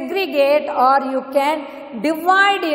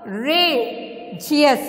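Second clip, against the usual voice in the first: "courageous" drawn out slowly in pieces; "courageous" slowly divided for learners; syllable by syllable; slower than usual. Shown as a demonstration. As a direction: say "courageous" with a gap between syllables. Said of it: "You can see the movement".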